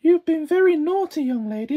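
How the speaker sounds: loud prim scolding; prim schoolmarm. Acted. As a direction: scold like a strict governess.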